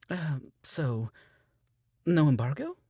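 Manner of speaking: timid question. A narrator's reading. Brenda checking timidly.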